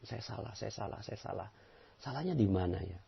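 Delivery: very soft, murmured to oneself; soft inward confession. Shown as a demonstration. Preacher models humble self-reflection, softly murmured. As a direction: whisper the confession into a mirror. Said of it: "Jadikan cermin ajaib".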